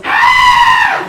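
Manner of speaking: high, loud startled yell; adrenaline yell. Retold or imitated. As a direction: let out a loud shocked yell. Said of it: "the only thing I could get out was".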